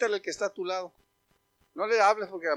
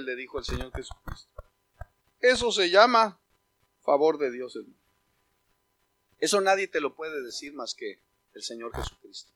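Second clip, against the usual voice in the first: reverent hush; 5 semitones lower than usual. Real, not acted. Preacher closing gently over music, reverent.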